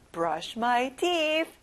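very slow and clear; slow model phrase. Shown as a demonstration. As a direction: stretch it for the class to repeat. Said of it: "그리고 이를 닦다".

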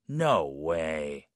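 low-pitched, doubtful "no way"; disbelieving. Shown as a demonstration. Teacher showing practice answer to Everest claim, disbelieving. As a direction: drop it low, finding it hard to believe. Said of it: "어, I don't believe it".

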